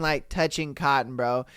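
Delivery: ordinary talk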